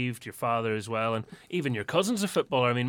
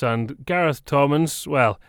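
ordinary talk

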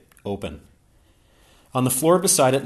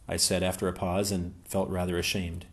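usual speaking voice

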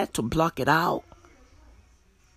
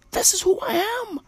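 higher and fast, a squeaky chicken voice; much faster than usual. Pretend. Vlogger voicing a calm chicken, high and fast. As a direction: play the chicken's line high and quick.

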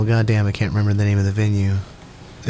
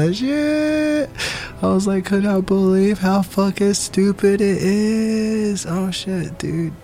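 high giggly voice breaking with laughter; laughing; 9 semitones higher, slower than usual. Real, not acted. Vlogger cracking up over silly tweets, high and giggly. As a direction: laugh through the words, pitched way up.

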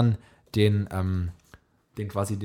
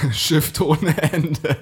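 laughing; 2 semitones higher, much slower than usual. Real, not acted. Joke punchline through laughter.

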